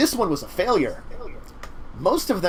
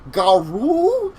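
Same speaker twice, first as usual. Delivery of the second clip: high, loud Minnesotan question; drawn-out mock Minnesotan, pitched up; Minnesotan accent; 9 semitones higher, 6 dB louder than usual. Cockroach man groping for werewolf names, Minnesotan accent. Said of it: "I did a really good Michigan accent".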